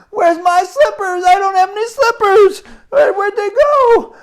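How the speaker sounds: put-on squeaky small boy pitched far up, looking around; worried child. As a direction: fret like a kid who lost his slippers. Boy searching for lost slippers.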